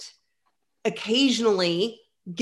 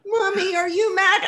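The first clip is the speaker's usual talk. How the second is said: put-on child voice; 10 semitones higher, 4 dB louder than usual. Child asking mom.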